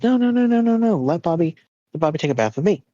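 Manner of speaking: high-pitched rapid string of refusals, then pleading; mock child protest, pitched well up; protesting little boy. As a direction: imitate a kid begging to get his way.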